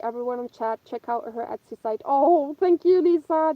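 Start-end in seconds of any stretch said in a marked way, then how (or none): 2.0-3.5 delighted thanks. Real, not acted. Thanking a viewer for praise.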